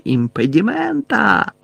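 high, drawn-out triumphant spell; тріумфальний вигук. A narrator's reading. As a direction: call the spell slowly, stretched out in triumph. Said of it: "тріумфально заволали". (протяжний тріумфальний вигук)

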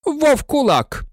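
high, ringing announcement of the topic; teacher proclaiming a new subject; announcing. A narrator's reading. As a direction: announce it sharply, pitch leaping up. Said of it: "оголосив Снейп".

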